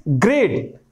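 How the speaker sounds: ग्रेट said slowly with the g and the t fully sounded; careful demo of great. Shown as a demonstration. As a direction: pronounce ग्रेट deliberately as one syllable. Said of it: "आप देखेंगे ग और ट".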